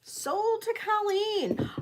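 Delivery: drawn-out high sold call; auctioneer call. Acted. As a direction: auction countdown, stretched out slow. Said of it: "I'm gonna count this down for you".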